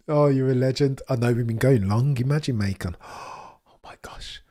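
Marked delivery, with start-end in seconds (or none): 3.0-4.4 breathless whispered gasp, slowed right down. Real, not acted. Streamer gasps in a whisper at a viewer's message.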